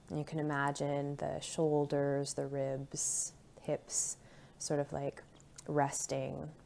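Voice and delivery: soft, calm meditation voice with long pauses; hushed, soothing body-scan guidance; guided meditation. Real, not acted. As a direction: settle listeners gently into their bodies, unhurried.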